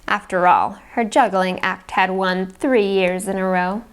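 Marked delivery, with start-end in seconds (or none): none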